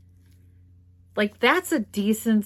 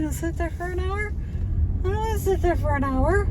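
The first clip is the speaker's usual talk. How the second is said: pitched way up, mock disbelief at waiting an hour; high incredulous whine; 12 semitones higher than usual. To herself about the early play, high mocking whine. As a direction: squeak it out, exasperated and mocking. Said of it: "I was like".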